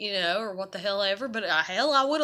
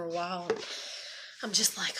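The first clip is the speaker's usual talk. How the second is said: whispered; 5 semitones lower, 5 dB quieter than usual. Real, not acted. Whispered lead-in to musing.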